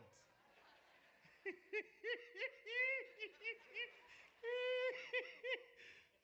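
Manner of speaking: high, loud laughter; long high laugh. Real, not acted. Speaker laughs at his own boast to the crowd, high laughter. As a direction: crack up at his own joke.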